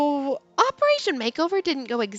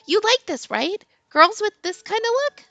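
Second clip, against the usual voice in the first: put-on high, coaxing teen girl; high girly voice; 8 semitones higher than usual. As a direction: fish for his approval. Heroine voiced in read story.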